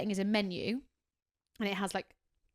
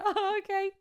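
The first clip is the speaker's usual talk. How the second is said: mock surprise; 11 semitones higher, slower than usual. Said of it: "I was like".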